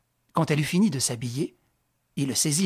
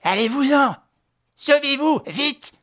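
much higher, louder, frantic warning; old innkeeper begging them to flee; panicked stammer; 12 semitones higher, 8 dB louder than usual. Acted. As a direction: blurt it out in terror.

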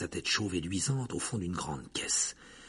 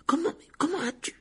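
small, very high squeak; Hermione. A narrator's reading. Friend astonished that Harry is here, small shrill voice. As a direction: stammer in astonishment in a tiny high voice. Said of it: "s'exclama Hermione d'une petite voix aiguë".

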